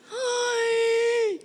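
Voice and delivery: high whiny ay of despair; high comic wail. Retold or imitated. Stuck behind old lady, high comic wail.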